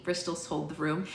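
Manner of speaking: ordinary delivery, nothing marked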